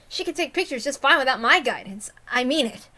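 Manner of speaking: ordinary delivery, nothing marked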